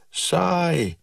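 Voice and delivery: high mocking call of boredom; a girl calling down a high bored word. A narrator's reading. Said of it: "roept Walda".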